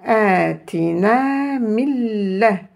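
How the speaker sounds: Atina mille chanted very slowly, vowels drawn out; slow Arabic recitation. Shown as a demonstration. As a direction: stretch Atina mille like Quran reading. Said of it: "on dira mille".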